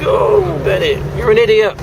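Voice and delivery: annoyed driver heckling the car ahead; exasperated jeer. Real, not acted. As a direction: sneer at the driver who cut in. Cursing another driver.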